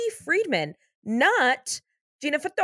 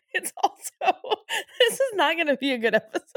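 cracking up, high-pitched; laughing; 7 semitones higher, faster than usual. Real, not acted. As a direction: barely get it out through laughter. Cracking up over botched start.

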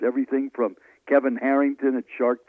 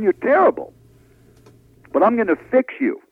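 harsh verdict; 9 semitones higher, 5 dB louder than usual. Retold or imitated. Mentor's harsh verdict retold.

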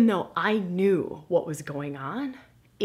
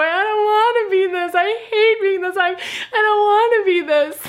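high, loud laugh-crying; laughing wail; 9 dB louder than usual. Retold or imitated. Answering the chiropractor, loud high laugh-crying. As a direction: sob and laugh through it, much higher and louder. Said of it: "I'm wailing".

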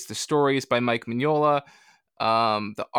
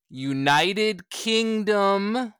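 very slow, raised pitch on each word; drawn-out emphasis; 7 semitones higher, much slower than usual. Pretend. Guest addresses UK listeners about the trap, drawn-out emphasis. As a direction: stretch the name out in a put-on voice.

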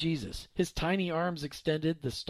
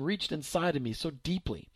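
plain reading voice

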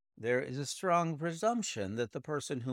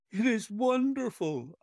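high, bright burst of amazement; exclaiming in wonder; 11 semitones higher, 4 dB louder than usual. A narrator's reading. Watson amazed by Holmes's reasoning, exclaiming higher. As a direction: marvel out loud at the deduction. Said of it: "I exclaimed".

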